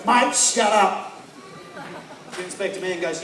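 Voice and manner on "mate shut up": slow, loud telling-off; enormous heckler-silencer. Retold or imitated. Huge front-row dude silences heckler, slow loud order. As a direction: order it slowly, voice raised. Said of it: "enormous dude in the front row stands up and goes".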